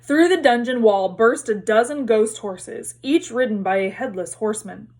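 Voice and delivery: plain reading voice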